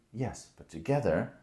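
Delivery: usual speaking voice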